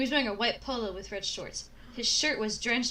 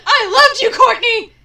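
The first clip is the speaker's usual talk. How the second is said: anguished cry; 12 semitones higher, 18 dB louder than usual. Acted. Dramatic love confession.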